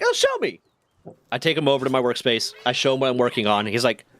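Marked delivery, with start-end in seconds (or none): 0.0-0.6 very high squeaky imitation of the chief; mock Chief voice. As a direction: squeak it like a cartoon boss. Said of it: "So he's like".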